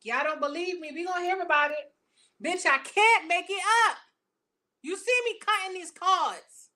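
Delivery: high-pitched, loud disbelief; emphatic insistence. Real, not acted. Reader insisting to doubtful viewers, high and emphatic. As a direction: make them believe what the cards show.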